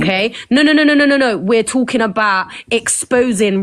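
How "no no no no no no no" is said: raised, insistent no's to cut her off; loud repeated no. Real, not acted. Streamer cutting off a viewer's sly comment, loud insistent no. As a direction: shut the comment down hard and loud.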